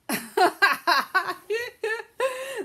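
loud, high, long peal of laughter. Real, not acted. Seller amused by the FaceTime idea, laughing hard. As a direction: crack up loudly. Laughing at a viewer's funny comment.

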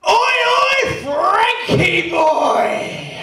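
huge high bellowing shout across rows; geezer shout. Retold or imitated. Geezer bellowing to his mate across the stands. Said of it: "he makes this noise".

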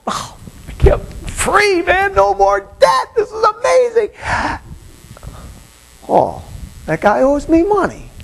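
high, elated voice of a man let off; giddy freed slave. Acted. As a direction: celebrate like you just got free. Voicing the freed slave's joy.

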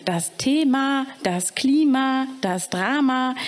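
slow, friendly enumeration of das Thema, das Klima; friendly listing. Acted. Siad explaining grammar to the caretaker, slow and friendly. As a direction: count them off kindly and slowly. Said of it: "begann freundlich aufzuzählen".

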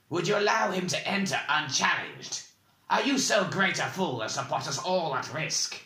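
high-pitched, incredulous challenge; angry demand. A narrator's reading. Bald man challenging the dwarf with barely chained rage, demanding. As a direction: demand an answer in fury. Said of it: "demanded the bald man".

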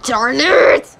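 real mild outburst of annoyance; getting mad. Real, not acted. Gamer missing a shot, getting mad. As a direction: let irritation slip out. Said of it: "Sorry, I'm getting mad".

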